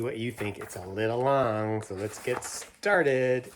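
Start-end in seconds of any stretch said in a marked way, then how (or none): none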